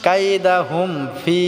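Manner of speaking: কাইদাহুম with a short দা, then ফি stretched; slow correct demo, ফি lengthened one alif; short da, long fi. Shown as a demonstration. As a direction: keep দা brief and hold the ee of ফি. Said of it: "ফিটা আমরা এক আলিফ টেনে পড়ব".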